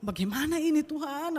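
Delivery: high, re-enacted cry to God; retold upset appeal; exasperated plea. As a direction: plead upward in frustration. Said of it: "Saya kesel banget waktu itu".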